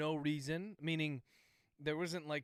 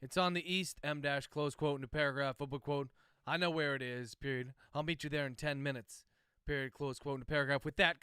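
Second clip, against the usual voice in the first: dictating; 4 dB louder than usual. Real, not acted. Author dictating novel chapter.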